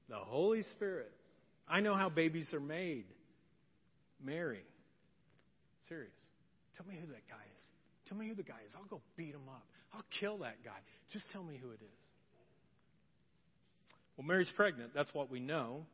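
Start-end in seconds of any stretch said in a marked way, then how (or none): 0.1-12.0 low, hushed, threatening demands; quietly seething, wanting the man's name; hurt angry Joseph. Acted. Joseph voiced confronting Mary.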